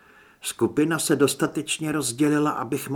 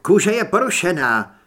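higher, loud alarmed shout; 5 semitones higher, 8 dB louder than usual. A narrator's reading. Trip shouting over Zed's bite, loud and alarmed. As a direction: call out the wound in alarm. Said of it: "vykřikl Trip".

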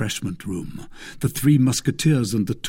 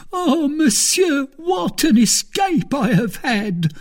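very high, tearful wail; terrified servant; 4 dB louder than usual. A narrator's reading. Planchet to his master after nearly drinking poison, terrified. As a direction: gasp at a narrow escape in a high-pitched fright. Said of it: "stammered Planchet, more dead than alive".

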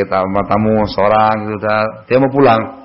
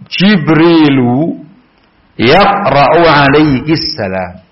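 bacaan hadis; 3 semitones higher, much slower than usual. Acted. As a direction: bacakan sabda dengan khidmat. Membaca matan hadis.

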